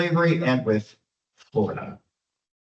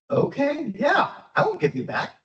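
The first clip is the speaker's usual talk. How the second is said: much higher, mock-magnanimous governor; high-pitched governor granting refuge; governor's reply; 12 semitones higher than usual. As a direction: play the obliging governor, voice way up. Governor answers the slaves' request.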